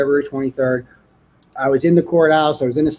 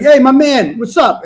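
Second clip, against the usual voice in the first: higher, louder mock buddy greeting; fake-friendly cop; 7 semitones higher, 6 dB louder than usual. Officer pulling up to the driveway, hammed-up friendly. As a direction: greet him like an old pal before the bust.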